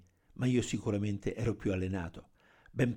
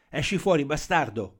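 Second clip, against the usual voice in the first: raised, higher-pitched yell; louder, forceful command; angry shout; 5 semitones higher, 6 dB louder than usual. A narrator's reading. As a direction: shout it out at a fleeing suspect.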